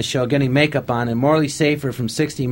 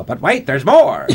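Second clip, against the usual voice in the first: slow, hammed-up pitchman line, half laughing; Ross Porter impression; 5 dB louder, slower than usual. Pitchman in the truck-driver infomercial sketch, slow hammed-up announcer. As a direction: lean into the infomercial pause. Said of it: "doing an imitation of Ross Porter".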